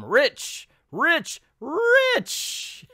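hammed-up villainess greed climbing in pitch; gloating. Villainess gloats over becoming rich.

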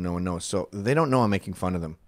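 ordinary delivery

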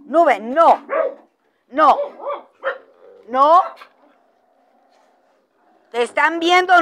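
loud, high repeated no's to a dog; sharp reprimand. Real, not acted. Correcting a misbehaving dog.

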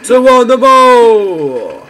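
loud slow stretched Geronimo; drawn-out battle cry. Real, not acted. Leaping from a ledge in the game, loud slow battle cry. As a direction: holler it as you leap.

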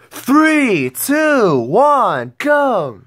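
high, loud race countdown; excited countdown. Acted. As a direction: count them down to the start.

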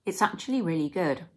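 slightly full mouth; licking spoon while speaking; talking between spoonfuls. Real, not acted. Seen 0: Spoon at lips until 2.1s, then held away while talking.

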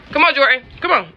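loud, high-pitched dog call; coaxing the dog. Real, not acted. Owner calling a hiding dog, loud high coaxing. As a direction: urge a reluctant pet to come.

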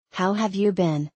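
ordinary talk

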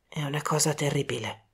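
low, hushed, with emotion creeping in; grave and quiet, understated emotion; moved. A narrator's reading. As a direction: say it softly, touched by what you see. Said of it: "Nella sua voce si era insinuata una commozione".

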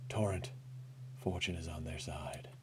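unvoiced hushed whisper; soft secretive whisper. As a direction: breathe the words like a secret. Voicing whispering NPC.

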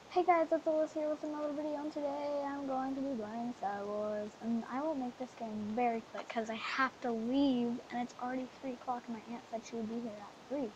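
fast hurried intro; rushed. Real, not acted. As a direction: rush through it. Rushed intro before leaving.

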